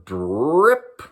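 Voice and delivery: slow imitated drip, rising; drip sound. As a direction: drag the drip out slowly like a drop falling. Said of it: "it's making that noise".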